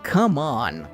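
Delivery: ordinary delivery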